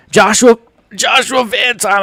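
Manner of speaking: excited high hype call; hype shout. Real, not acted. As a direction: shout it hyped up for the fight. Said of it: "I can't even scream".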